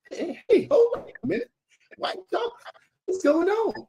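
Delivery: high-pitched mock holler; high retold holler without extra volume; imitated hollering. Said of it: "he's hollering over the, the, the speaker".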